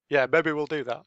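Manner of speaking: ordinary talk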